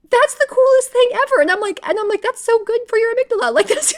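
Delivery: high, loud, gushing delight; gushing excitement. Retold or imitated. Admiring people who cry easily, high and loud with gushing delight. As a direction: rave about it like it's the best thing ever. Gushing envy over easy criers.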